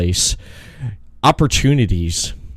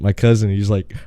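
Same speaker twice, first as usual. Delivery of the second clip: usual speaking voice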